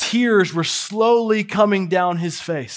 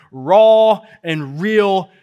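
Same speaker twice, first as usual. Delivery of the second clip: slow emphasis; 5 dB louder, much slower than usual. Real, not acted. Preacher lingering on key phrase.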